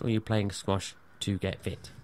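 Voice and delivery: ordinary talk, nothing marked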